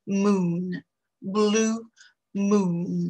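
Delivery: blue moon with a long rounded oo, lips pushed forward; English oo in blue moon. Shown as a demonstration. Teacher showing French learners blue moon with a long oo. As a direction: say moon as a long tight oo, like a small kiss. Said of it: "quand on va vouloir prononcer blue".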